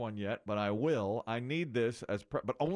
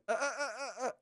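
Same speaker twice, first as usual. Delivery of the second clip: flustered correction; 9 semitones higher, much faster than usual. Retold or imitated. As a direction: catch yourself mid-slip. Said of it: "and I say".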